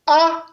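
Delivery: A held on a high, level tone. Shown as a demonstration. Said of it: "le ton haut". (« A » au ton haut)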